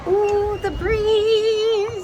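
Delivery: sudden high, loud gasp of surprise; surprised. Real, not acted. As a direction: caught off guard by the wind. Cold breeze hits outdoors.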